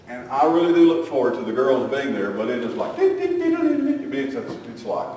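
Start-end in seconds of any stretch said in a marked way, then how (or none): 3.0-4.1 high mock doorbell. Imitating kids' constant interruptions.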